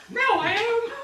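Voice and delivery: high cartoon Mario voice; squeaky plumber voice. Puts on red hat, Mario.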